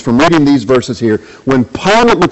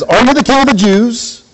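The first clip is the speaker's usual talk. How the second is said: much higher, incredulous Roman governor; Pilate questioning; 9 semitones higher than usual. Acted. Preacher playing Pilate, asking Jesus in disbelief. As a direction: ask it as a sceptical ruler.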